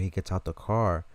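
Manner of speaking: ordinary talk, nothing marked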